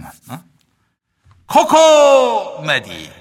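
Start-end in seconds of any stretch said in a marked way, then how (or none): 1.5-3.1 loud, drawn-out call; echo call. Acted. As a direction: stretch it out for the echo. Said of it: "내 이따 만 원 드릴게".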